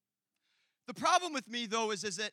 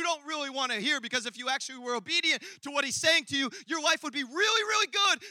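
rapid preaching, building louder and higher; talking really fast; 3 semitones higher, 7 dB louder than usual. Real, not acted. Preacher telling some they have blocked out God, rapid building delivery. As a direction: rush it out, rising to a loud peak. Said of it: "I'm talking really fast".

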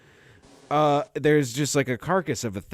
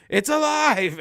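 mock horror cry; 5 dB louder, slower than usual. Horror-movie exclamation.

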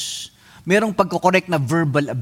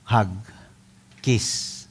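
normal talking voice

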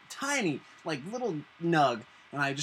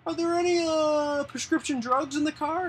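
much higher, louder cop voice, mocking; imitating the cop; 12 semitones higher, 8 dB louder than usual. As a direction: put on a high officious cop voice. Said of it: "he goes".

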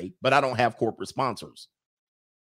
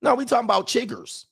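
high mock Southern voice; 10 semitones higher than usual. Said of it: "Or did you say".

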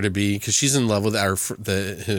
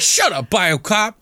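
annoyed shout; 9 semitones higher, 3 dB louder, slower than usual. Retold or imitated. Character shouts at Biocop.

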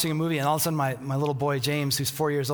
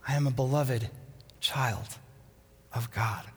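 fading to a whisper; 3 semitones lower, 4 dB quieter than usual. Acted. Answering critic voices, fading.

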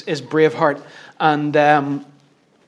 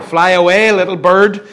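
louder, mocking call; taunting voices; 3 semitones higher, 7 dB louder than usual. Inner voices tell the crushed believer to flee, called out. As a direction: call it out at them loudly. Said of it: "do hear voices saying".

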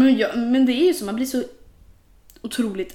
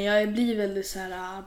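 ordinary talk

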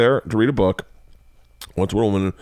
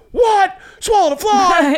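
very high screamed outrage; shrieking stand-up rant; Sam Kinison; 4 dB louder, slower than usual. Acted. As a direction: scream it in disbelief, stretched out. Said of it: "I'd read it like, um, Sam Kinison".

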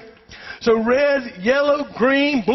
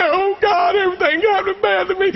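mock whiny complaint; 7 semitones higher than usual. Pastor mocking complaining believers, whiny and high. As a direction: ham up a self-pitying prayer. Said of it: "get all, uh, sissified and say".

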